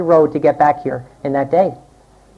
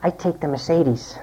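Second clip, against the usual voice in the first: much softer, slow hushed advice; whispered tip; 4 semitones lower, 9 dB quieter than usual. Retold or imitated. Carmaker whispers into buyer's ear, softer and slow. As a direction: lean in and slip them the secret. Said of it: "he whispered in your ear".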